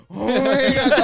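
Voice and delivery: loud, high Oh my God; loud exasperation. Retold or imitated. Comedian turning to the crowd in loud disbelief. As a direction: blurt it out to the crowd. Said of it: "I turn to the crowd, like".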